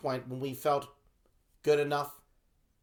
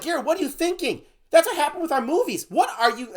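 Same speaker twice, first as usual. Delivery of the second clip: much higher and louder, exasperated; mild mock fury; 8 dB louder than usual. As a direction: scold your partner, toned down. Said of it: "I'm doing a mild version".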